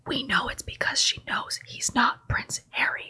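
hushed conspiratorial aside; whispering. Real, not acted. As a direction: whisper it like a secret.

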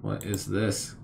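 ordinary talk

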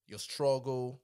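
ordinary talk, nothing marked

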